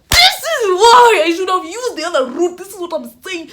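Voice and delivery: loud, high cry of regret; dismayed outburst. Retold or imitated. Student caught by the deputy on the way, dismayed outburst. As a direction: wail it out, high and drawn.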